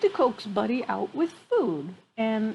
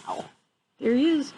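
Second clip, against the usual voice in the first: ordinary delivery, nothing marked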